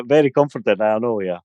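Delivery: usual speaking voice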